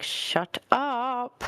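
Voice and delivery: mock-polite shut up. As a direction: snap at the teasing host sweetly. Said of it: "she's just like".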